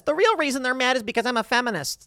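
high, put-on complaining quote; mock feminist voice. Host imitating a feminist explaining criticism, in a mocking high voice.

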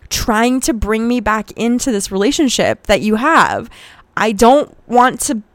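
high, loud exasperated protest; retold outraged outburst, stretched words; reenacted furious scream. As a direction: act out screaming at an ex, voice pushed up. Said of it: "and I screamed at him".

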